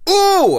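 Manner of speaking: very high, loud delighted ooh; delighted squeal. Real, not acted. Cute character reaction.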